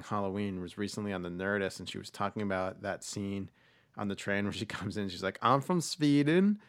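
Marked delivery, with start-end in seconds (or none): 5.4-6.6 put-on Swedish lilt, higher and drawn out; mock Swedish accent. Actress on a train introducing herself, mock Swedish accent. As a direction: imitate an actress's bad Swedish accent, slowly. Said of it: "a German accent. She couldn't do it".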